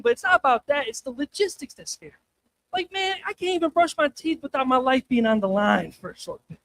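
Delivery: ordinary talk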